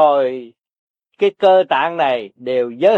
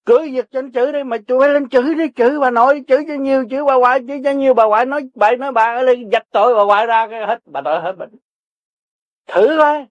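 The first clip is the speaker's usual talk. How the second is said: egging them on; 6 semitones higher, faster than usual. Acted. Old teacher urging people to curse.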